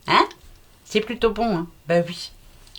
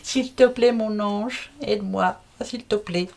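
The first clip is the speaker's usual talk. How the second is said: mock prayer; slower than usual. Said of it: "Je vais prier".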